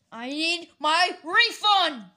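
loud, high, word by word; insistent angry shout. Pretend. Refused customer insisting on a refund, slow angry shout. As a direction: stretch out each word in a loud demand.

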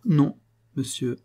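plain reading voice

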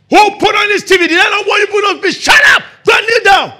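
loud, very high furious shouting; domineering parent. Retold or imitated. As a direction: rage at the child over the TV. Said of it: "You have the domineering parents".

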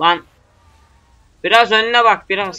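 loud shout. Real, not acted. Shouting at reckless driver.

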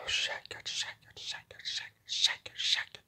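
repeated word, fully whispered; soft unvoiced whispering. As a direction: hush it, almost secretive.

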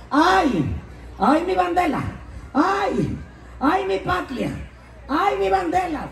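put-on high wailing Cuban accent, bandera as bandela; Cuban lament. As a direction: wail for the flag in a Cuban accent. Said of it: "un cubano gritando". (lamento cubano agudo, bandera como bandela)

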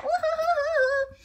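very high, slow hooting; monkey hoot. Acted. George hooting at the ostrich.

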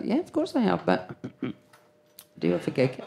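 very soft, trailing of course I have; sheepish mumble. Retold or imitated. Mother to her daughter, sheepish and very quiet. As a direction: mumble the guilty admission.

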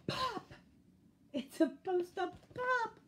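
very high-pitched protest; outraged squeal. A narrator's reading. Skunk frustrated at balloon, high squeal. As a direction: punch each pop in a high voice.

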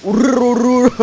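high loud rolled r on うるるるるる. Real, not acted. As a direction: trill the r hard through うるるるるる. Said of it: "めちゃめちゃ巻き舌になった".

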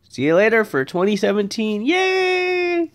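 high, squealed yay; excited cheer. Real, not acted. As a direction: cheer the new year in. Cheering New Year sign-off.

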